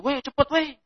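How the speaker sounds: high, loud, hurried call through the door; impatient knocking student. Retold or imitated. Student pounding the bathroom door, calling high and loud to hurry up.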